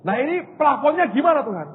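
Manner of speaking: high, loud pleading to God; pleading question. Retold or imitated. Pastor pleading with God, retold.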